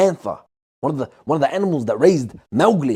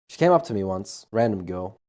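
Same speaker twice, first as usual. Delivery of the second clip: usual speaking voice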